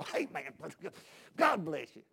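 lightly put-on evangelist greeting; a touch of mock revival preacher. Visiting evangelist greets a church brother, lightly mocked preacher voice. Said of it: "You get the evangelist thing".

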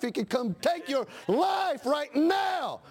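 slow, high, shouted warning; preaching shout. Real, not acted.

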